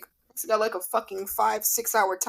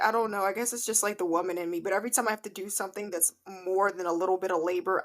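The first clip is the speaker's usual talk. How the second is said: ordinary talk, nothing marked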